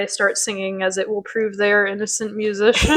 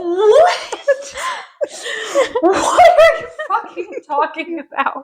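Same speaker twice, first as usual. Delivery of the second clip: laughing disbelief; 13 semitones higher, slower than usual. Real, not acted. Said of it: "S0 reacts laughing".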